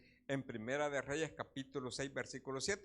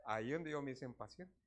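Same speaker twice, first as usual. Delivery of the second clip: normal talking voice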